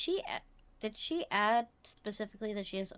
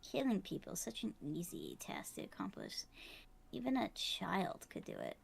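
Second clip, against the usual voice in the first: gravelly villain voice; 5 dB quieter than usual. Acted. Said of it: "This gravelly voice that I do for her".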